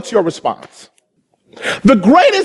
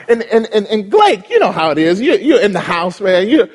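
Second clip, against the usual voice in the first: normal talking voice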